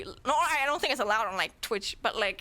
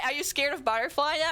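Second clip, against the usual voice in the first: ordinary talk, nothing marked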